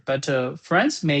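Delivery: ordinary talk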